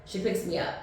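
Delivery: usual speaking voice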